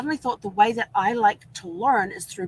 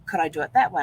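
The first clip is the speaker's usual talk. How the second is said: ordinary talk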